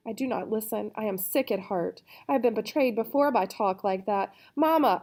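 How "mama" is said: loud, high cry for mama; bawling. Acted. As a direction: bawl it out like a hurt child. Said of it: "I bawl".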